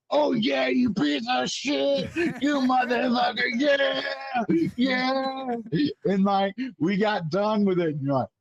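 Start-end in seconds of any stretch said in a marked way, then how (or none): 0.1-5.6 high, hammed-up yelling at a puking buddy; mock screaming. Retelling the captain screaming at him while he pukes, high hammed-up yell. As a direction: scream encouragement like a drill instructor, much higher. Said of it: "you're just like".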